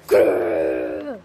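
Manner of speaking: deep angry growl. Acted. Grounded kid growls.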